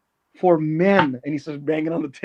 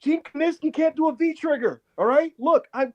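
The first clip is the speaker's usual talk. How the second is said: much higher earnest debater; pitched-up mock of a calm analyst; J J Redick arguing; 7 semitones higher than usual. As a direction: argue like a TV panelist, pitched up. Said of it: "going like".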